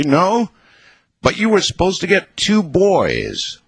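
high-pitched, forced-sweet reproach; sweetly through gritted teeth. A narrator's reading. Husband reminding wife they wanted two boys, forced sweetness. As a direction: feign loving calm through gritted teeth. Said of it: "in my most loving, calm, sweet voice".

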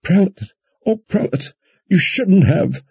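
high, frightened tearful cry; tearful fright. A narrator's reading. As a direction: cry out in distressed alarm. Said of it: "gasping in tears of fright".

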